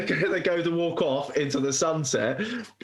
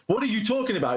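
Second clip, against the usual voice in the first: higher-pitched incredulous question, acted out; mock bewilderment; 6 semitones higher, faster than usual. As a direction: play-act being baffled by the question. Acting out the film's confused exchange.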